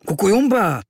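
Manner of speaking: high, loud reproachful exclamation. Acted. Kvíkvék reproaches the boy, high and loud. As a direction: scold a friend for leaving. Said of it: "řekl trochu vyčítavě".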